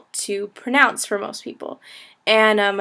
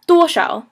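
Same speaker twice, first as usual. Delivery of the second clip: Duo stressed, shao quick and light; teacher stressing duo in duo shao; 5 semitones higher, 4 dB louder than usual. Shown as a demonstration. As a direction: hit Duo, rush shao. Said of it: "the emphasis is on the duo".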